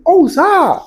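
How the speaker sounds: excited oh sir. Real, not acted. Excited exclamation.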